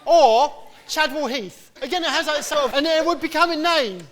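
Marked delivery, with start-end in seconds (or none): 0.1-1.7 put-on high, loud and slow kid's call; loud high childish call; mock child shout. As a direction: draw it out like a shouting boy. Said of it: "And my son".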